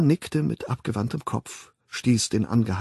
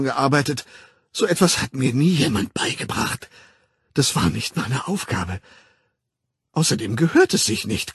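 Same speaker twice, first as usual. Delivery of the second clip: indignant Linda, pitch often jumping higher; aufgebrachte Linda; 4 semitones higher, 4 dB louder than usual. Acted. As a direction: complain with growing outrage. (empört klagend, sich steigernd)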